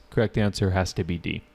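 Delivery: normal talking voice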